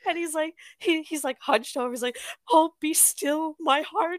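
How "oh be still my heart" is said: high, hammed-up lovestruck swoon; melodramatic. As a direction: imitate a theatrical romantic, slow and high. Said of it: "this guy is dramatic".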